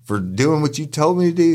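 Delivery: high-pitched, incredulous protest; indignant disbelief. Retold or imitated. Guard sent home by his boss for following orders, indignant. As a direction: question it in outraged disbelief.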